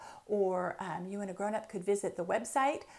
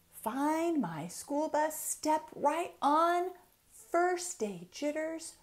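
lilting, rhythmic picture-book reading; reading to children; 6 semitones higher, slower than usual. Acted. Author reading her book aloud to children, lilting storytime. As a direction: read each rhyme like a bouncy storytime.

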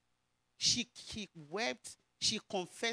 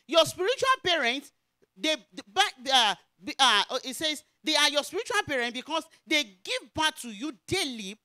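raised, high scripture proclamation; forceful preaching; 6 semitones higher, 6 dB louder than usual. Real, not acted. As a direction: proclaim it loudly to the congregation.

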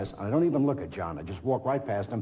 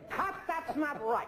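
much higher, slow, laughing; director caught the voice; 4 dB quieter, slower than usual. Retold or imitated. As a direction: drag it out high while laughing. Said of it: "I'm trying to imitate them".

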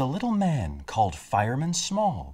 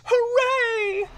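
very high, squealed hooray; high, drawn-out mother rabbit cheer; shouted cheer; 4 dB louder than usual. A narrator's reading. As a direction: cheer out in joyful relief. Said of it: "Mother Rabbit shouts".

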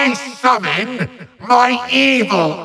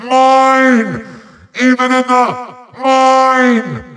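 loud, high, insistent cries; possessive shouting; 5 semitones higher, 3 dB louder, slower than usual. Acted. Villain claims the eternal fire source, high possessive shout. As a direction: shriek your claim like a tantruming villain.

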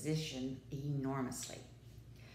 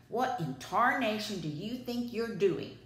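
angry shout; 5 semitones higher, 5 dB louder than usual. A narrator's reading. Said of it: "the farmer shouted".

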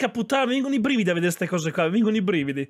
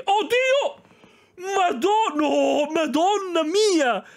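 explosive italian cursing; 8 semitones higher, much slower than usual. Real, not acted. Shocked reaction to crash.

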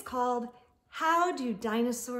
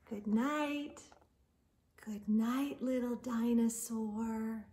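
lively storybook read-aloud; expressive picture-book narration; reading to children; 3 semitones higher, 7 dB louder than usual. Acted. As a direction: read it to kids at bedtime, animated.

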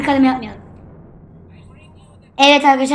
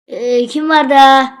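usual speaking voice

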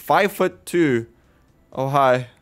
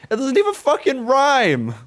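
much higher, exasperated cry; exasperated outburst; 10 semitones higher than usual. Real, not acted. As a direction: throw up your hands at it. Exasperated at game poem.

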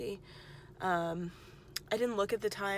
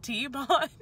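imitating her friend; 7 semitones higher, 7 dB louder than usual. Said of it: "my friend goes".

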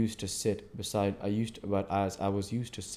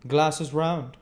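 loud, higher call across the room; 8 semitones higher, 7 dB louder than usual. A narrator's reading. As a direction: call out the round to the landlord. Calling to the landlord.

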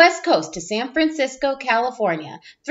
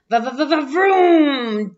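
revving engine vroom. Acted. Engine noise in truck story.

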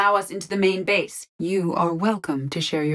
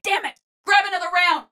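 very high-pitched urgent cry; frantic combat shout; 12 semitones higher than usual. Acted. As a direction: curse and order under fire. Cursing and ordering in combat.

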